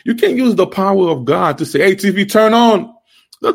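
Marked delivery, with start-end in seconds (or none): 1.8-2.9 mock command.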